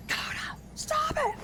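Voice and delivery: hushed, slow whisper at the dog; hiss-free hushed telling-off, slowly; whispered scolding. Retold or imitated. Scolding dog, neighbor overhears.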